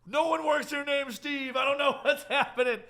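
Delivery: high, laughing mock shout; hammed-up baffled boss at full volume; exasperated protest. Boss tells corporate there is no Steve, mock exasperated shout.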